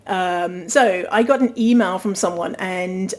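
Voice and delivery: ordinary talk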